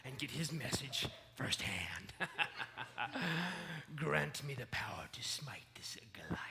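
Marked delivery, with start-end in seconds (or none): 4.0-6.4 whispered prayer. Acted. Muttered prayer before the fight.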